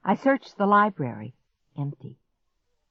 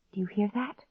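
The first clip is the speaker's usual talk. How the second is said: soft, raised question; hushed listening; 4 semitones higher, 7 dB quieter than usual. A narrator's reading. Girl hears something outside, hushed. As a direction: ask it while straining to hear.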